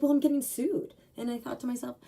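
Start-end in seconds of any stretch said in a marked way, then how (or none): 0.0-0.9 high, louder whiny protest; a pitched-up mock of the client. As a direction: play the client's outraged excuse. Quoting client, high and loud.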